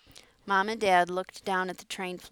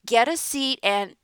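yelled much louder and higher, cut short; shouting over wind; 5 semitones higher, 10 dB louder than usual. A narrator's reading. Conductor ordering refugees to sit, shouting over wind. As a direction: bark the order over the storm. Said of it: "cut off by the ear-splitting shrieks".

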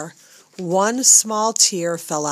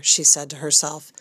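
usual speaking voice

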